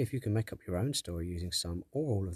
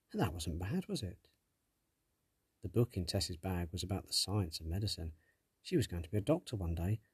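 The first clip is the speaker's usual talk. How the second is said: usual speaking voice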